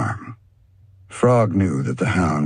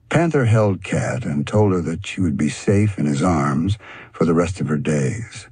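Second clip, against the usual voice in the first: ordinary delivery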